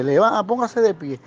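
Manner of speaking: mock police order, high and sharp; cop's commands. Retold cop order.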